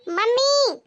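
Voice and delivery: high, loud cry for mother; angry yell. Acted. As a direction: yell for mummy in a temper. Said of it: "इतने गुस्से में क्यों चिल्ला रही है".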